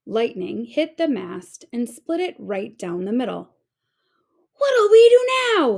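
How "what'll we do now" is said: loud, high panicked pirate yell. A narrator's reading. Pirate panics after the mast splits, loud high yell. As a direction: yell it in panic over the storm. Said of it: "yelled one of the pirates".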